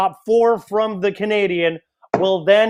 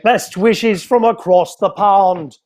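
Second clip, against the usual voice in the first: mock English accent, slow and hammed-up; playful, badly faked English accent; British accent; 3 semitones higher, 3 dB louder, slower than usual. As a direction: put on a terrible British accent. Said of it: "when I do my British accent or my English".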